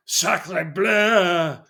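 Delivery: shouted fake French. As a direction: bellow phrasebook French like a pompous officer. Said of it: "various other random phrases in vaguely racist French that I can shout".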